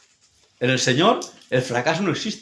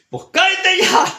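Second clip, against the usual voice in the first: high-pitched, loud retold outburst; comic high yell of impatience; exasperated mock shout; 4 dB louder than usual. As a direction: shout it as a fed-up imitation.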